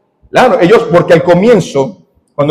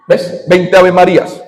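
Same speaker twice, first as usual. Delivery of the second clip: ordinary delivery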